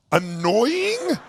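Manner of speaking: incredulous echo. Retold or imitated. Incredulously echoes the partner's word.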